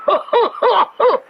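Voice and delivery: mocking laugh. Stranger laughs again.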